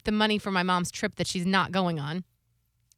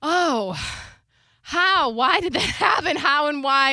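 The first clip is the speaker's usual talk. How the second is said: higher, louder amazed questions; stunned disbelief; 7 semitones higher, 5 dB louder, slower than usual. Retold or imitated. She hears she gets half back, amazed disbelief. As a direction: gasp out how it could happen. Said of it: "I was like".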